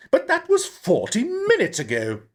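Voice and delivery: high, near-tearful fretting; worried director. A narrator's reading. Mrs Hatfield to the pub, high and near tears. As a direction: let worry crack through.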